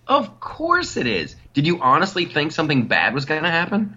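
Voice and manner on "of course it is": sarcastic, higher pitch. Acted.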